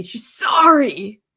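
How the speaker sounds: a touch of Mary's voicemail voice; imitating Mary. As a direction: drag out sorry the way Mary does. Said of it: "It's her tone of voice".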